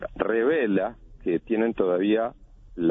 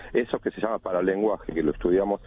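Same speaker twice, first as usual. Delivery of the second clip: ordinary talk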